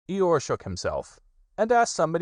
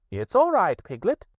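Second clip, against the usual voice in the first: high, louder soothing rabbit; calm Piglet down; high kind Rabbit; reassuring Rabbit; 12 semitones higher, 5 dB louder than usual. Acted.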